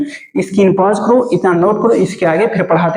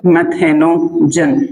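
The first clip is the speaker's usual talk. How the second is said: मेथेनोजन drawn out slowly, part by part; slow, stretched-out मेथेनोजन; slow dictation; much slower than usual. Shown as a demonstration. Teacher dictating term for notes.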